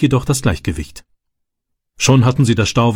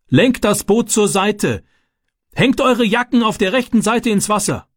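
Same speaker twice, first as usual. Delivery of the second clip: bellowed orders; 10 semitones higher than usual. A narrator's reading. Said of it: "brüllte der Mann". (gebrüllte Befehle, hoch und gedehnt gerufen)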